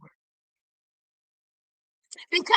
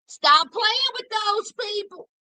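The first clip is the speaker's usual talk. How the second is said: ordinary talk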